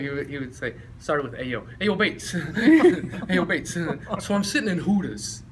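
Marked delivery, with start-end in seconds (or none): 1.4-3.7 lightly imitated teammate's catchphrase; a hint of his buddy's greeting; Ernie Batto. Teammate greeting his pal by name, lightly mimicked with amusement. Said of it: "every time he would say my name".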